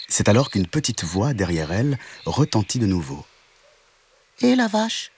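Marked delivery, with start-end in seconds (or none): 4.4-5.1 much higher, tiny kitten calling; a kitten hailing the cow; little kitten voice. A narrator's reading. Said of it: "un petit chaton tigré".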